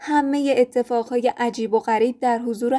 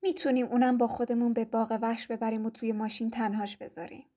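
softer scheming undertone; quietly; 9 dB quieter than usual. A narrator's reading. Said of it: "با صدای آهسته گفت".

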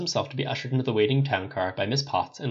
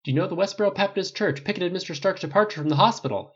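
higher-pitched reporter's question; raised press voice, short of shouting; correspondent asking; 9 semitones higher, 4 dB louder than usual. A narrator's reading. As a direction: put the question pointedly into a microphone. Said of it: "he asked again".

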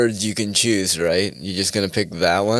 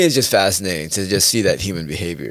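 usual speaking voice

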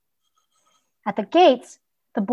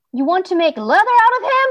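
fast, high, loud horrified cry; boy cries out at the tanner, high and fast; 9 semitones higher, 5 dB louder, faster than usual. Acted. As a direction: blurt it out in horror. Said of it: "the boy cried".

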